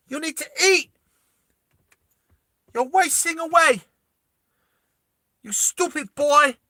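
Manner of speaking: high, loud scolding mother; abusive mum. Acted. Abusive Italian mum to her skinny boy, loud high scolding. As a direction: berate your boy at a high pitch. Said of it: "it's his abusive mum".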